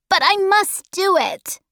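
put-on high child's insistence; determined boy. Charles Wallace insisting despite the danger, high determined boy.

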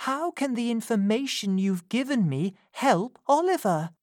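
pleading Rose. A narrator's reading. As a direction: ask her not to go back. Rose asking.

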